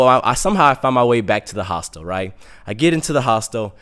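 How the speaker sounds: ordinary talk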